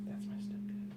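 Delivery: quick hushed whisper aside. Real, not acted. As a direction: whisper so the caller can't hear. Phone call, whispering aside.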